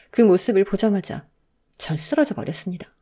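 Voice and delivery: ordinary delivery, nothing marked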